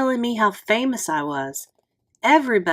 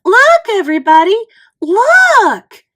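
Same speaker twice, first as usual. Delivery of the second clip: much higher and louder call to the crowd; 12 semitones higher, 10 dB louder than usual. A narrator's reading. Judy calling the schoolyard kids, loud and thrilled. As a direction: shout for everyone to come look. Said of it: "Judy called".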